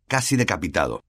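plain reading voice

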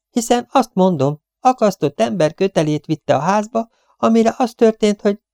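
high-pitched garrulous storyteller; chattering cleaning woman, insistent. A narrator's reading. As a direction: press on with your superstitious tale. Voices the talkative cleaning woman. (fecsegő takarítónő, magasabb hangon)

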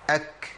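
أك with a long puff of air after the k; exaggerated breathy k. Shown as a demonstration. Teacher exaggerating the whispered k for students. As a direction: push breath out hard on the final k of أك. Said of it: "لازم نبالغ شوية عشان نبين".

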